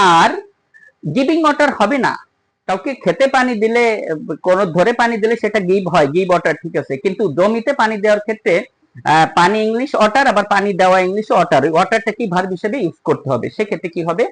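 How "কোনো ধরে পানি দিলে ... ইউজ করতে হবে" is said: normal talking voice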